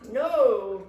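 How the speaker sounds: loud, annoyed refusal; angry. A narrator's reading. Tortoise refusing Squirrel, angry. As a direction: refuse forcefully. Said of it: "Tortoise said angrily".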